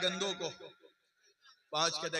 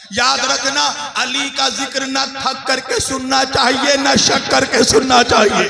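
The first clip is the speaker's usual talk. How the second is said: loud exhortation, building in force; forceful preaching; 6 semitones higher, 16 dB louder than usual. Real, not acted. Preacher telling listeners how to hear Ali's praise, building loud exhortation. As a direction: drive it home, louder to the end.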